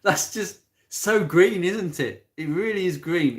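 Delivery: drip sarcasm on green; sarcastic. Real, not acted. Mocking green electric cars.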